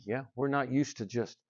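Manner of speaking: ordinary talk, nothing marked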